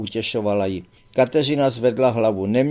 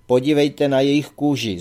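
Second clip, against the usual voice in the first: usual speaking voice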